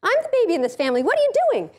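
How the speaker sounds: put-on high, indignant little kid voice; a small child protesting her place as the baby; pouty toddler whine. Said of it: "your little kid is going".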